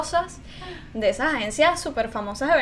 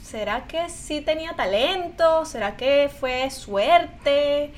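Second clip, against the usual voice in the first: slow, doubting self-question; much slower than usual. Retold or imitated. Thinking about her talent after years away, she wonders slowly.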